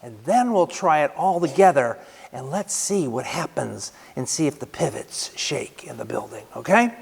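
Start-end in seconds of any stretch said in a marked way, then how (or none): 2.3-6.9 hushed conspiratorial whisper; playful whispered aside. Real, not acted. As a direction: lean in and whisper to the congregation. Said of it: "whisper heard".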